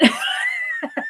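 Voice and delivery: sudden high squealing laugh; high burst of laughter. Real, not acted. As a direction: let out a high shrieking laugh. Laughing at her own anger.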